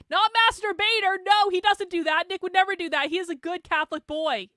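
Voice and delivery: loud, high protest; loud, high, fake outrage; hammed-up denial, voice climbing; mock indignant correction. Real, not acted.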